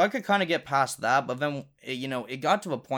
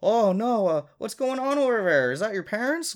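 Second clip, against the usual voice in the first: concerned grandmother in a higher, loud voice; imitated Nana; 8 semitones higher, 5 dB louder than usual. Nana hearing yelling over the phone, imitated concern. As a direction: ask it like a worried granny, pitched up. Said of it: "And my Nana's like".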